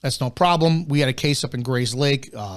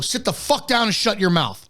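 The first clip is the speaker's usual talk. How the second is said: higher, barked mock command; harsh command; 7 semitones higher than usual. Officer showing the escalating way at a domestic, mock harsh command. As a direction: snap the order like an aggressive cop.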